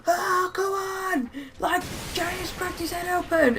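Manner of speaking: high, drawn-out mock yell at modest volume; mock shouting. Brother calling out that Jay has cracked his head open, mock shouting. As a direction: imitate a kid calling out, stretched and high. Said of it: "he was shouting them really loud".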